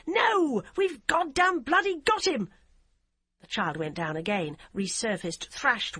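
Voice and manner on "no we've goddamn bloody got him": high, loud furious insistence; furious refusal. A narrator's reading. DCI refuses to stop the boat, high loud furious. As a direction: refuse her hard.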